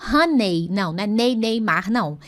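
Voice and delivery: normal talking voice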